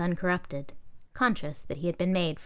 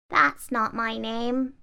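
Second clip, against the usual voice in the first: high, very slow, weak protest; 5 semitones higher, much slower than usual. Acted. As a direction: doll protesting the laptop's taunt, high and weak. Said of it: "the doll protested weakly".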